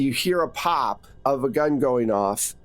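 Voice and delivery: ordinary delivery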